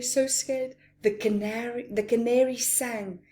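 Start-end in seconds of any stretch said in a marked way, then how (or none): none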